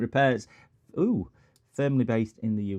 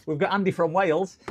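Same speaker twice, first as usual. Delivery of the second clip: raised, lilting mock Welsh accent; terrible Welsh accent; 7 semitones higher, 4 dB louder than usual. Streamer introduces Andy from Wales, terrible Welsh accent. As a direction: put on a bad Welsh accent. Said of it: "doing terrible accents".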